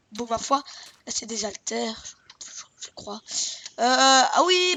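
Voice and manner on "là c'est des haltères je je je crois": whispering. Real, not acted. Whispered aside.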